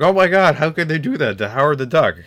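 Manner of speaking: loud whiny outraged fan; angry nerd. Retold or imitated. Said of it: "Someone's pissed off, like".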